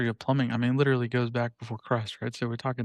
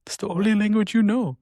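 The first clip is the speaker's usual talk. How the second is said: a mock disappointed parent, higher and a bit louder; imitating Indian parents; 7 semitones higher, 4 dB louder than usual. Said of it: "my Indian parents".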